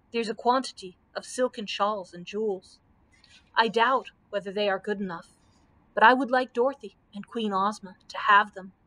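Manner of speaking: ordinary delivery, nothing marked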